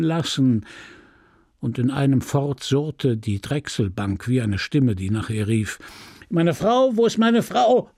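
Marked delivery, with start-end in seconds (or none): 6.3-7.9 anxious husband calling out, pitch pushed up; calling out for his wife through the house; shouting. A narrator's reading. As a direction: cry out for his wife, raised. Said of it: "schrie Charles".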